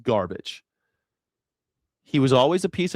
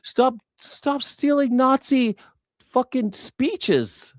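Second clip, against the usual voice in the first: high, slow, hammering each word; much higher, drawn-out exasperated scolding; exasperated slow rant; 8 semitones higher, slower than usual. Real, not acted. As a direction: lay it down word by word, fed up. Furious rant at Nazis.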